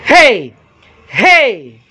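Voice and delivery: loud, high test shouts; shouting again. Shown as a demonstration. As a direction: yell hey to push the meter. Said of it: "ulit sisigaw ako ulit".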